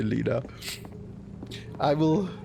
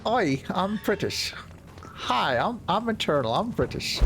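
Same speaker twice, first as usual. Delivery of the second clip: higher-pitched, hammed-up British accent; mock British accent; 6 semitones higher than usual. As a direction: badly fake a swooping British accent. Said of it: "That's very British".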